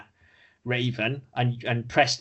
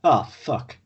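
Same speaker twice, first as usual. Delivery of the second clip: matter-of-fact. Retold or imitated. Co-host voicing Roose's response to the whole situation, matter-of-fact. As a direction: imitate a blunt, unbothered reaction. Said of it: "He's very matter of fact".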